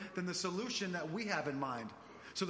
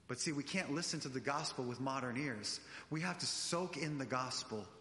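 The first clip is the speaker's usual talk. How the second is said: ordinary talk, nothing marked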